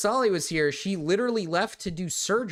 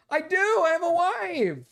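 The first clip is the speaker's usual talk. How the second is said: indignant exclamation; 11 semitones higher, 4 dB louder than usual. Real, not acted. Defending himself to chat.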